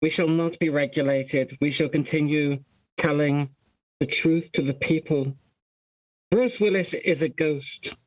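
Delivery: ominous Anonymous voice. Pretend. Anonymous declaration, lowered voice.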